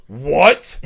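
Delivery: loud, imitated angry disbelief; mock indignant "what?". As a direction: blurt it as a hammed-up offended dad. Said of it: "like he's mad".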